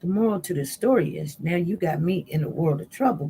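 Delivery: ordinary talk, nothing marked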